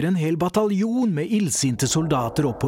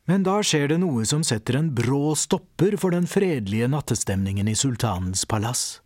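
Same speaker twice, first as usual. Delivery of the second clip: ordinary delivery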